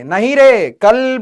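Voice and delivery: usual speaking voice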